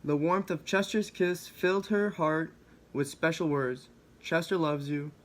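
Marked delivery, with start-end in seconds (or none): none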